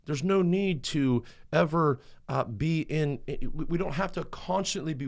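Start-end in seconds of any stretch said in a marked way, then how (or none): none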